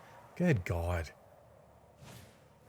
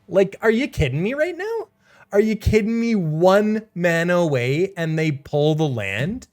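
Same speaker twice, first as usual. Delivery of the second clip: exasperated disbelief; 6 semitones higher, 3 dB louder than usual. Real, not acted. Bad draw, player disbelieving.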